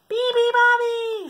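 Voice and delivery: very high, slow shriek. Acted. Seals wake the bird, shrieking. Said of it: "shrieked the seals".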